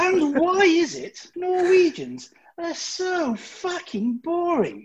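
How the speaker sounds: very high, drawn-out announcer voice; mock ship announcement. Crewman mocking Norwegians over the tannoy, mock grand announcer voice. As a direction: stretch it out as a lofty mock proclamation.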